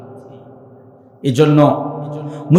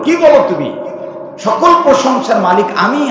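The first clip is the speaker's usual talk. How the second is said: indignant high-pitched rebuke; angered God; 8 semitones higher than usual. Acted. Preacher voicing God rebuking a careless worshipper, angry. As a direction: challenge them in offended anger, pitch raised. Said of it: "আপনার উপর রাগান্বিত হয়ে গেল".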